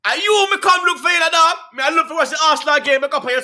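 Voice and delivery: mimicking the guy's Jamaican patois; Jamaican accent imitation. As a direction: throw it out in thick Jamaican patois. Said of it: "He said".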